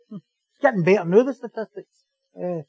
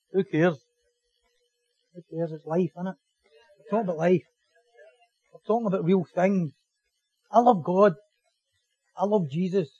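low, softer, earnest; sincere; 4 semitones lower, 5 dB quieter than usual. Real, not acted. Preacher talking to congregation about real life, quietly sincere. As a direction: drop down and speak plainly from the heart. Drops to sincere talk after comedy.

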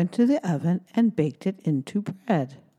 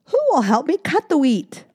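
higher, louder hen asking; 9 semitones higher, 5 dB louder than usual. Acted. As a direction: ask like a hopeful hen. Hen asks friends.

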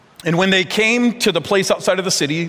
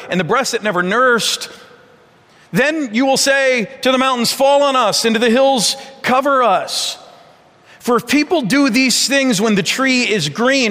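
a preacher voicing Jesus' lament; dramatic scripture reading; 5 semitones higher than usual. Reads Jesus' words aloud.

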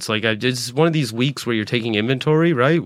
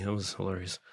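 ordinary delivery

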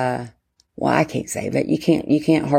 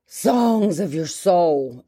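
slow reverent phrase; much slower than usual. Acted.

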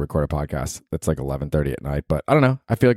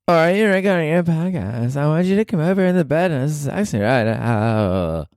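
put-on girlfriend voice; 8 semitones higher than usual.

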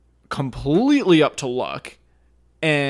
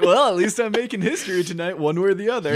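higher, hammed-up looking on the bright side; mock upbeat resignation; 6 semitones higher, faster than usual. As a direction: imitate a contestant making light of it, pitched up. Mocking contestant's too-calm acceptance.

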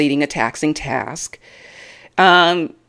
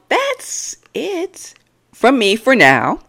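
sign-off; 9 semitones higher, slower than usual. Real, not acted.

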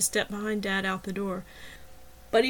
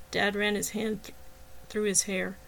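ordinary delivery, nothing marked

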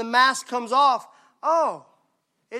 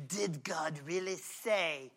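slow, soft, hushed serpent tempting; sly serpent voice; 5 semitones lower, 11 dB quieter, slower than usual. Acted. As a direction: whisper the doubt in like a creeping snake. Serpent's question in Eden.

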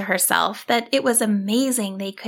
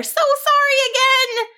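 high, louder frightened squeal; yelped apology; 4 dB louder than usual. A narrator's reading. Sheep apologizing as she flees the wizard, panicked yelp. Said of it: "yelped out another".

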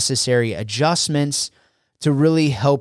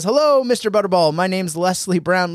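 higher, faster, brighter hello as if meeting him fresh; passionate greeting; 6 semitones higher than usual. Retold or imitated. Young Les greeting the station manager again, higher and eager. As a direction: greet him like it's the first time, full of passion. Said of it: "I love how Les says it with passion".

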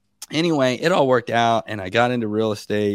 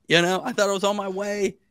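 ordinary talk

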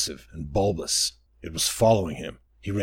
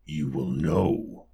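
low, slow menacing murmur; lower, slow creepy creature voice; creature whisper; 7 semitones lower, much slower than usual. Acted. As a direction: breathe it close like a lurking toad. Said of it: "Mbuta whispered".

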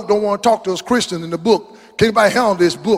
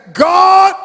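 shouted call; 10 semitones higher, 6 dB louder than usual. Acted. David calling out.

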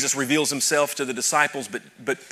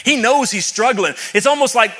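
high, loud, chanted preaching; full-throated revival preaching; preaching at full force; 9 semitones higher, 8 dB louder than usual. Real, not acted. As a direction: build to a shouted climax.

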